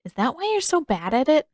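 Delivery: put-on high, cheeky little boy. A narrator's reading. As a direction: tease her innocently. Boy teasing Katara.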